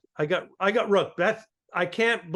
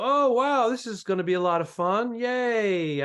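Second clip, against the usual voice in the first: higher-pitched put-on cheer; mock enthusiasm; 7 semitones higher than usual. Comic greeting a row of little girls onstage, mock enthusiasm. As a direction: act thrilled for the joke. Stage joke at child audience.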